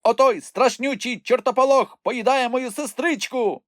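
high, panicked wail; frantic screaming. A narrator's reading. Khropus screams for his captured sister, frantic. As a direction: cry out in desperate alarm. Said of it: "який несамовито волав".

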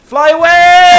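high, loud gleeful yell; excited shout. Real, not acted. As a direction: yell it as you soar away. Taking off in game.

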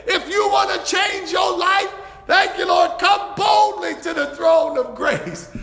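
shouting the invitation; excited preaching. Real, not acted. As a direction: let it build with excitement. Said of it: "I'm getting excited here".

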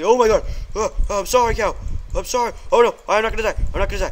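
high, loud panicked cries; real panic as mobs close in; panicked yelling. Real, not acted. Gamer to his audience, yelling in real panic.

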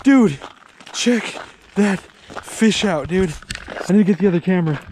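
can't contain himself over the catch; excited. Real, not acted.

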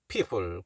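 "people" low, with both Ps made by closed lips. Shown as a demonstration. Teacher showing the P in "people", lips meeting. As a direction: press the lips together for each P in "people". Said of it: "입술이 붙는 피읍이죠".

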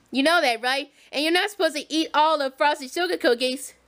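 deep motherly warning; Harriet voice. Pretend. Harriet lectures again.

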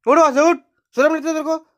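high, loud mock telling-off; mock scolding. Owner playfully scolding the pet for taking his spot, high and loud. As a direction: scold him off the bed in jest. Acted quarrel over sleeping spot.

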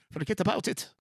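exaggerated Irish-accent attempt; imitated Irish accent. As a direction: mangle the phrase in a put-on Irish accent. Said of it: "that Irish accent".

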